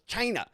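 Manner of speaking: mimicked "China", high and falling sharply; Donald Trump. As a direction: punch "China" high, imitating him. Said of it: "he knows that that felt good when he goes".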